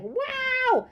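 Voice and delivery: high squealed wow; excited wow. Acted. Teacher showing why she chose exclamation. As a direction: shriek it with delight. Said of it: "wanna emphasize that".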